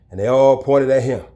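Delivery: normal talking voice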